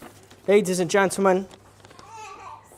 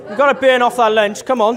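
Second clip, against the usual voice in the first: loud, raised call to the room; 5 semitones higher, 6 dB louder than usual. Real, not acted. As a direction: call out to get everyone moving. Urging guests to get moving.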